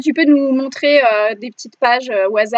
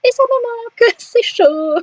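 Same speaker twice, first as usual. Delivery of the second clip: mock tearful; 11 semitones higher, slower than usual. Real, not acted. Regrets missing conventions, nostalgic.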